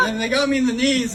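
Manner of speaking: ordinary delivery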